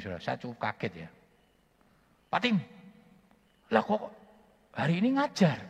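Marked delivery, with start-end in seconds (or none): 2.3-5.6 high, loud, astonished question; shocked they see him at work; surprised colleague. Retold or imitated. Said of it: "teman-teman ngomong begini". (teman kaget dengan suara tinggi)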